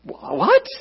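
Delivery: high, startled stammer; a scared kid caught off guard; nervous stammer. Retold or imitated. Boy facing older muggers, nervous stammer. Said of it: "I'm nervous".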